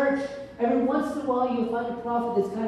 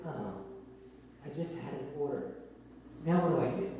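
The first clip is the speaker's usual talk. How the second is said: much lower and softer, a sinking sigh; deflated pastor; 6 semitones lower, 10 dB quieter, faster than usual. Acted. Pastor watching his sheep scatter, quiet and deflated. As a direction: let the air go out of it, dismayed.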